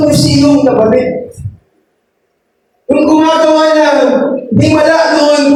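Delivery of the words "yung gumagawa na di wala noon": impassioned, high-pitched preacher's exhortation; fervent preaching. Real, not acted. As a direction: drive each phrase home, pitched high. (masidhing pangangaral, mataas ang tono)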